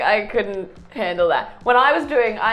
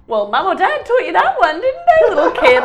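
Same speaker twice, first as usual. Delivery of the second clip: sarcastic retort; 8 semitones higher, 6 dB louder than usual. Retold or imitated.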